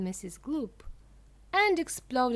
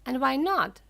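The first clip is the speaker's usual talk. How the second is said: louder, higher shriek; 5 semitones higher, 9 dB louder than usual. A narrator's reading. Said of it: "shrieked Missus Gloop".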